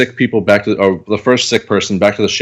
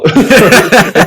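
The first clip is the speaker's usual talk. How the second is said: gross burst noise; 11 semitones higher, 5 dB louder than usual. Retold or imitated. Imitating body bursting.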